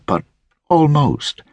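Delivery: ordinary delivery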